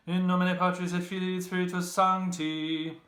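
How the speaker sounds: high, loud intoned Latin blessing; mock priest intoning. A narrator's reading. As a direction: intone it like mass at the altar.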